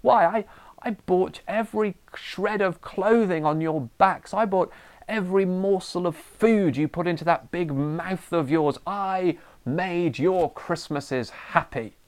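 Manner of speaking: high-pitched, exasperated tirade, slowing on the last lines; ranting parent. Pretend. Tired parent ranting at fighting children at night, high exasperated rant. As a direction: let the tired parent boil over at the kids. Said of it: "you burst into your children's room and say".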